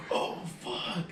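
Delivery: high, gasped mock panic; freaking out. Kid sees the dog with the hamster, freaking out. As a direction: gasp it out in a retold panic. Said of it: "I'm freaking out".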